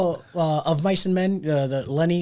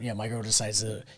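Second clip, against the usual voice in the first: ordinary delivery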